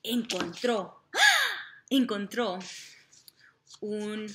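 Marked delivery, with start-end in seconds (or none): 1.1-1.7 high, loud cry of surprise; sudden startled ah; surprised gasp. Acted. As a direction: yelp as if spotting treasure. Surprise at finding ring.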